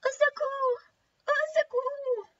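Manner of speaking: very high, small trapped voice; cries for help. Acted. Girl and grandmother trapped in wolf's belly, high-pitched cries. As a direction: call out high from far inside. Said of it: "les cris du petit chaperon rouge".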